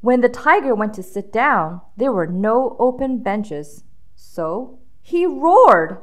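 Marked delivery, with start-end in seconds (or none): none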